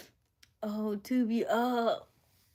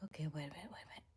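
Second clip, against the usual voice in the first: whisper; 2 semitones lower, 12 dB quieter, much slower than usual. Real, not acted. Whispering while reacting on stream.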